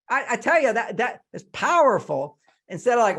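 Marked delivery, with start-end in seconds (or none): none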